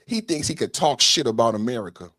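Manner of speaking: ordinary delivery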